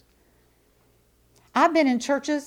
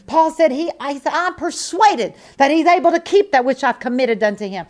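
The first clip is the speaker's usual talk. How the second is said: loud, ringing declarations of faith; preaching at full force; 4 semitones higher, 6 dB louder than usual. Acted. Preacher rousing his congregation, loud full-force preaching. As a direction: preach it out, building to the peak.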